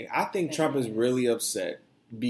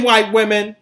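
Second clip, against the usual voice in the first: emphatic repeat; 8 semitones higher, 11 dB louder than usual. Real, not acted. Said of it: "Let me say that again".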